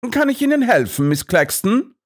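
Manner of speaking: high, fast, mock-polite; spring out with false politeness; sly Holmes. A narrator's reading. Holmes blocks Miss Claxton, high and mock-polite.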